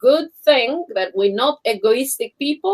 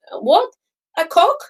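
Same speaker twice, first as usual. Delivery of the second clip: puzzled bartender; 7 semitones higher than usual. Retold or imitated. Bartenders puzzled, voiced in retelling.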